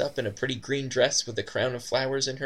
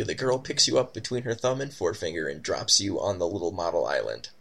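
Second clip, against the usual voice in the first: normal talking voice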